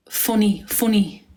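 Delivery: put-on practising an Irish accent, word by word; falling, repeated attempt at Irish accent; imitated Irish accent. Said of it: "my accent's perfect".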